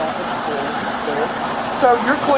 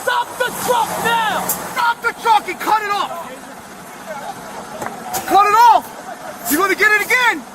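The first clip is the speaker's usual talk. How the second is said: urgent yelled orders; shouting commands; 7 semitones higher than usual. Real, not acted. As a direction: yell over the engine. Officers yell at moving truck.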